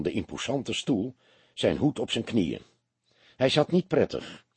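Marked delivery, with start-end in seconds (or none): none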